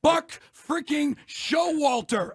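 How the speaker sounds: slow, loud, disgusted Buck freaking Showalter; scornful emphasis. Real, not acted. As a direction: drag out each word with contempt. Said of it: "Why you gotta say it like that?".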